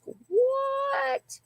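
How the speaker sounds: high squeaked disbelieving what; shocked what. Retold or imitated. Hearing she is sole beneficiary, a high shocked what. Shocked reaction to inheritance news.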